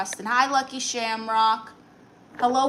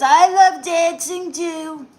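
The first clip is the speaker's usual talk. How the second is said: much higher and louder, slow, put-on voice; put-on Trump voice; 6 semitones higher, 9 dB louder, slower than usual. Reads a chat message from "President Donald Trump" in a hammed-up put-on voice. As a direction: ham up a silly Trump read.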